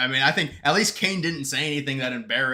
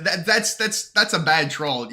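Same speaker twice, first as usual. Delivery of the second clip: ordinary talk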